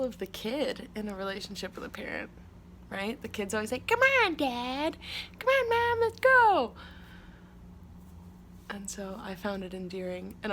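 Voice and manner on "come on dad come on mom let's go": put-on much higher, louder pleading kid, slow on Come on; whiny kid. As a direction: whine at mom and dad to hurry up. Kid whining at parents to move.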